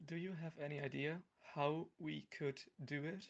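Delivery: usual speaking voice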